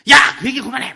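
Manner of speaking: loud, high annoyed yell; angry snap at child. Retold or imitated. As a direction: snap at the kid to stop. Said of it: "막 이러지 말고". (아이에게 버럭 소리치는 엄마)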